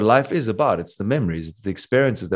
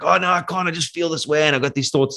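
higher-pitched, put-on helpless protest; mock helpless complaint; 7 semitones higher than usual. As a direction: act out someone giving in to their thoughts. Voicing a helpless, complaining mind.

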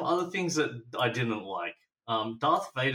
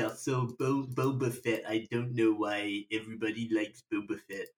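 lightly nasal American "Boba Fett"; nasal American accent; 4 semitones lower than usual. Shown as a demonstration. Still testing her American accent on the cohost. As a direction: keep the American accent, through the nose. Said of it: "It's, it's pretty nasally".

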